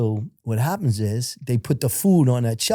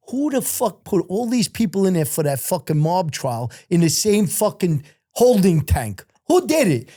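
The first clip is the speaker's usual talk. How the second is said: raised, higher-pitched imitated outrage; retold angry demand; 6 semitones higher than usual. Lieutenant demanding who mixed the prisoners, angry retold demand. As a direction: demand answers, jumping high at the end. Lieutenant berates staff over holding tank.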